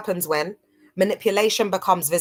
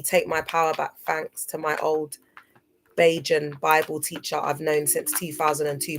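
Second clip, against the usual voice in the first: usual speaking voice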